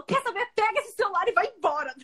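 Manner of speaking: high exasperated dismissal; exasperated teacher. Retold or imitated. Fed-up teacher telling the student to leave, high and exasperated. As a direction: throw up your hands and send her off.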